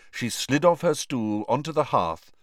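ordinary delivery, nothing marked

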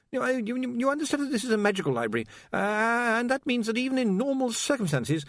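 high, stammering, anxious voice; nervous young wizard. Acted. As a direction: stumble over the words nervously.

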